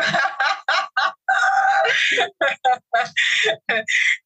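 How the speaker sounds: big high laughing shout. Real, not acted. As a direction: burst out laughing, thrilled. Host reacts with delight.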